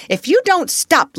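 high, loud snapping threat; sudden threat. A narrator's reading. Flustered teacher threatening a screaming child. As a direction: switch to scolding. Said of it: "then in quite another tone".

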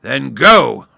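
very loud, high command; roared. Acted. Lion starting the fight with the gnat, roaring. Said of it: "roared the lion".

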